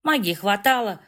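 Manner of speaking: ordinary delivery, nothing marked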